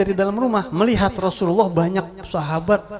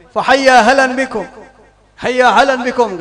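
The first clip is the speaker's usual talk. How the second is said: loud summons; 7 semitones higher, 10 dB louder than usual. Acted. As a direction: summon the crowd to the meal. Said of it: "kata Rasulullah". (seruan keras mengajak makan)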